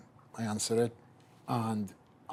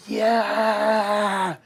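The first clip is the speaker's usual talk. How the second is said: a huge high, loud cheer; huge yell; 10 semitones higher, 9 dB louder than usual. Real, not acted. Celebrating his sponsorship over the phone, ecstatic yell. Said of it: "I gotta yell, mate".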